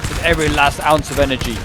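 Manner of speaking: a slow, stretched-out mock French accent; a light put-on French accent. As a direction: drift into a playful French accent mid-commentary. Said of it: "went into a French accent".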